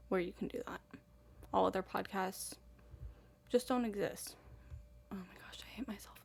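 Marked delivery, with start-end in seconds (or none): 5.1-6.2 quick embarrassed whisper to herself; whispered aside. Real, not acted. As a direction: mutter it under your breath, cringing.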